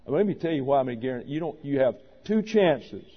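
usual speaking voice

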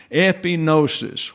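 Epinosis said eh-pih-NO-sis, stress on NO; common pronunciation of Epinosis. Shown as a demonstration. Teacher naming the much-used word, Epinosis stressed on NO. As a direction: land on NO, the third syllable of Epinosis. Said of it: "everybody wants to say epinosis".

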